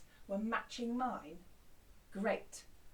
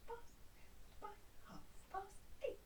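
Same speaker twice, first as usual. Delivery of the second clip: whisper; 8 semitones higher, 12 dB quieter, slower than usual. Shown as a demonstration. Whispered echo of chant.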